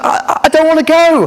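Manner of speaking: put-on high, childlike pleading voice; girl refusing to fly to Australia; upset little girl. A narrator's reading.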